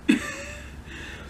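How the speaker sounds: sudden high-pitched laugh. Real, not acted. Vlogger laughing at her own anger with a high laugh.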